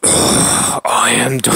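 slow, forced breath-heavy growl; deep voice. Acted. Breaking off the villain's introduction, deep voice. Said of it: "how do you do a deep voice?".

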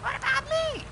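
put-on teen girl begging for attention; Meg voice. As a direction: squeak it out like the ignored daughter. Answered "Shut up, Meg.

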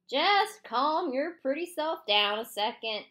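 slow, lazy drawl. A narrator's reading. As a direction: drag the words out lazily. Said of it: "in a slow drawl".

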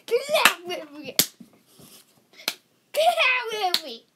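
loud, rallying call to the crowd; rally everyone to clap. Real, not acted. Host calls audience to clap.

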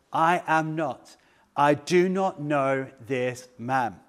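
slow, deliberate word-by-word denial; emphatic denial. Retold or imitated. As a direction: space out each word to deny it firmly. Peter's third, firm denial.

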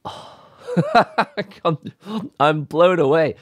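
breathless with amazement; overwhelmed and amazed. Real, not acted. Reacting to his first espresso, sighing, laughing and amazed. Overwhelmed after tasting shot.

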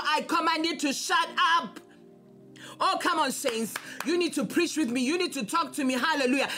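ordinary delivery

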